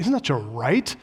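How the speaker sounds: ordinary delivery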